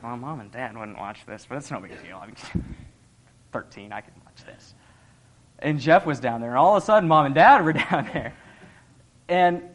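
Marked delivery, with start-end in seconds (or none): 0.0-4.7 hushed excuse. Retold or imitated. Teen self justifying movie.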